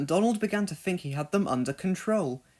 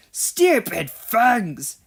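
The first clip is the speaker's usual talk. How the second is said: slow, loud angry scold; 6 semitones higher, 8 dB louder, much slower than usual. Acted. Donald scolding the naughty trucks, slow loud scold. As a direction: spit it out in frustration. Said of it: "he scolded".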